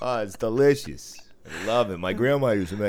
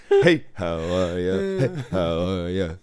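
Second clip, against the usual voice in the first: Homer Simpson; 6 dB louder than usual. Retold or imitated. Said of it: "Homer Simpson when he walks into".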